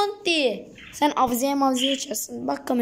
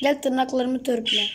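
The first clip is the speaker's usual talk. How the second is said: ordinary talk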